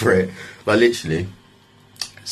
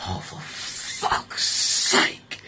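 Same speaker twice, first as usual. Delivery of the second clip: drawn-out, soft whispered curse; hushed dismay; 7 dB quieter, much slower than usual. Retold or imitated. Man seeing police walk up, whispered exasperated curse. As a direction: breathe it out very slowly.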